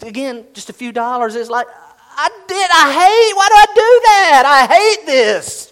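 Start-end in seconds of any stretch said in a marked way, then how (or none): 2.2-5.7 high, loud frustrated outburst at yourself; hammed-up self-loathing, drawn out at the end; frustrated self-reproach. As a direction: wail it in mock self-disgust. Spender's self-disgust outburst.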